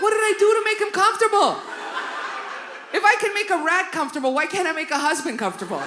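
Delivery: high, fast, loud rant; rapid, high self-pitying rant; exasperated. Retold or imitated. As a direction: fire it off in comic exasperation. Mock despair over rat joke.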